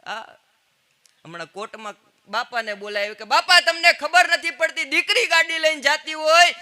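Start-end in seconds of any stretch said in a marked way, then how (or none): 3.2-6.5 put-on loud, high scolding of an old man; hammed-up telling-off from the bench; high, loud mock rebuke; mock courtroom scolding.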